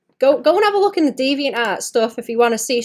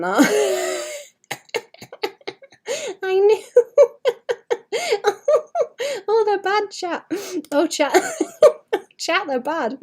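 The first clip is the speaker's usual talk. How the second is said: laughing; 10 semitones higher than usual. Real, not acted. Laughing to chat over her terrible old drawings.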